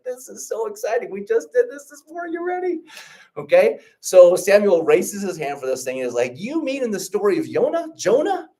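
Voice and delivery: bursting to share what he knows; over the moon excited. Retold or imitated. Samuel tells his teacher about Jonah, thrilled. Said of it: "over the moon excited".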